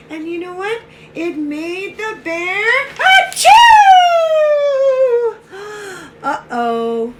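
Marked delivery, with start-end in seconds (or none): 2.9-6.2 loud, high, long-drawn achoo; huge mock sneeze. Pepper made the bear sneeze, huge mock sneeze. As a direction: explode into a drawn-out storybook sneeze.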